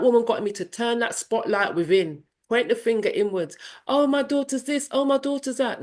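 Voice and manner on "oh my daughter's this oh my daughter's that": mock complaining. Mocking her own complaining.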